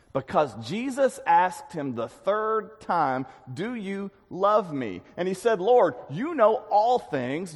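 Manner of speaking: slow, pressed-out emphasis on the hurt. Acted. Preacher pointing the congregation to Peter's hurt, slow and weighty. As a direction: lean in and slow down. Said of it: "note this, listen".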